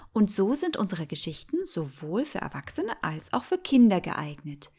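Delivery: plain reading voice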